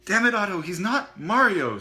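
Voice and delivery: very loud, high exasperated snap; angry snap. Acted. Sushi scolding Otto, angry. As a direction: snap at him in frustration. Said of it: "snapped Sushi".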